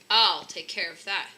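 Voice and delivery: a brave husband taking charge; bold declaration. Acted. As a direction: announce it boldly. Husband vows to catch burglar.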